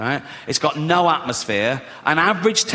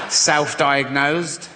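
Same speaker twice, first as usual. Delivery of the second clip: slow smug self-diagnosis; much slower than usual. Retold or imitated. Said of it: "What's the matter with you?".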